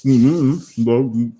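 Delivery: mumbling through a mouthful of peanut butter; mouth full of peanut butter. Real, not acted. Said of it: "hard to talk with peanut butter in your mouth".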